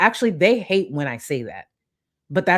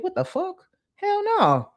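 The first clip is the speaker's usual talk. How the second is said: much higher, sassy outburst; mock outraged diva; 13 semitones higher than usual. Streamer mocking community drama, high sassy diva. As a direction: play the indignant queen refusing.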